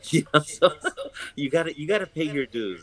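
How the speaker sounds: real laugh breaking into the line. Real, not acted. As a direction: chuckle your way through it, amused at yourself. Laughing at fetching coffee for mentors.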